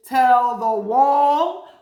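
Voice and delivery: slow, loud call to the room. Shown as a demonstration. Teacher calls to the class to shout the word. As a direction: tell the ceiling, loud and slow. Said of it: "call to the class".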